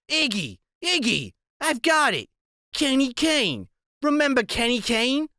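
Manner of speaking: blurting a sudden memory; excited woman. Acted. Narrator voices Loretta.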